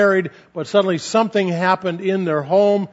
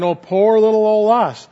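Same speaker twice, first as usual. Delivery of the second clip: whiny poor-little-me, raised and louder; sarcastic woe-is-us whine; mock self-pity; 4 semitones higher, 4 dB louder than usual. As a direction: pout it out like feeling sorry for yourself. Mocking self-pity of comparing upward.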